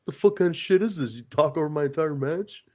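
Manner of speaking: mock angry rant. Retelling the locker room blow-up to cohosts, hammed-up outrage. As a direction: ham up a wrestler blowing his top. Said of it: "because he's like".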